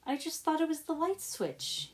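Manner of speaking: put-on high, wide-eyed little boy; innocent kid voice. Young gremlin protesting innocence to the twins, high and sweet. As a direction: play the innocent child caught out.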